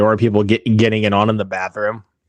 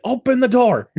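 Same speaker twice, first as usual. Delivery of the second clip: high mock yell; 11 semitones higher than usual. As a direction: shout it high at the door. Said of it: "It's funnier when she's like".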